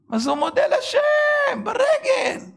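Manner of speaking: high, loud cry of wonder; preacher marvelling at the man's thanks, loud and high; amazed exclaim. Real, not acted. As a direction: shout it out in astonished admiration.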